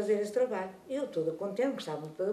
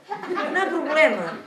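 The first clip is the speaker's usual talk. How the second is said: a rir; 6 semitones higher, 5 dB louder than usual. Retold or imitated. Avó tranquiliza a neta a rir.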